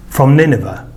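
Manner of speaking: plain reading voice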